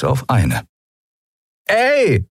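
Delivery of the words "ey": sharp high-pitched call to her mates; high, attention-grabbing shout. A narrator's reading. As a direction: yell to get their attention. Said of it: "rief die Menschenfrau".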